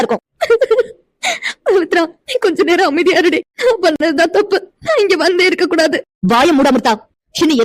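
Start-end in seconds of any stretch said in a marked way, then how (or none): 1.7-6.0 higher, crying through the words; weeping. Acted. Amirtha cries to her friend, blaming herself. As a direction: sob out the self-blame.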